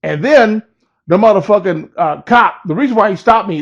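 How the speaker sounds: normal talking voice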